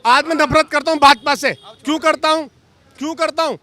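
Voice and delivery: loud, forceful outburst; angry. Real, not acted. Former party worker venting to reporter, angry and loud. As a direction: hit it hard, really worked up. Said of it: "[name] जी बहुत गुस्से में है".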